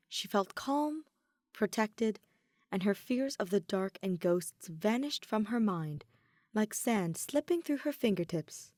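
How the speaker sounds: ordinary delivery, nothing marked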